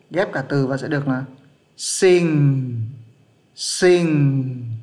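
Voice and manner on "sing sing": "sinh" drawn out to a long nasal end; English "sing", held on the final ng; slow blended sound. Shown as a demonstration. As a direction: stretch "sinh" slowly, letting it fall. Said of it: "chậm nó sẽ là như thế đấy".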